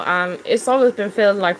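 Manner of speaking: ordinary talk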